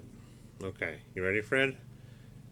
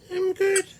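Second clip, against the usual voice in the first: very high, louder squeaky puppet voice; monkey puppet voice; 9 dB louder than usual. Acted. Uncle voicing Fred the monkey puppet for nephews, very high squeaky voice. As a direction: squeak it out like a little toy monkey. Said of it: "He's a monkey".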